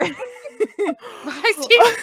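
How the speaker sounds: laughing, rising loud. Pretend. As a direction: squeal it through laughter. Echoing line while laughing.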